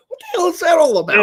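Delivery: loud, high, rapid-fire disbelief; exasperated outburst. Real, not acted. Host ranting to co-host about porn, exasperated outburst. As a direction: burst out in high-pitched bafflement.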